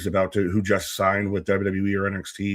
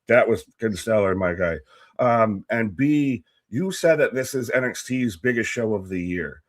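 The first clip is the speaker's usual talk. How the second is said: ordinary talk, nothing marked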